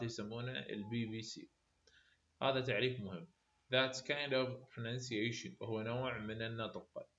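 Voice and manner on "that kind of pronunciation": very slow, word by word for note-taking; slow dictation. Shown as a demonstration. Teacher to students copying notes, slow dictation. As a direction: dictate it slowly so students can write. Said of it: "definition dictated".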